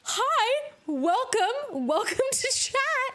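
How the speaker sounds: high, bright greeting; excited welcome. Real, not acted. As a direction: welcome newcomers with real excitement.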